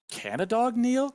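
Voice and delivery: high, loud disbelieving question; incredulous. Real, not acted. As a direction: ask it in disbelief.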